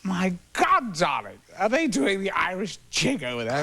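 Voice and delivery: put-on a touch of a theatrical drunk; tipsy O'Toole. O'Toole gawping at naked extras, lightly voiced theatrical.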